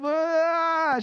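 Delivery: high, loud mock baby wail; baby's fussy wail. Father imitating daughter's frustrated wail, high.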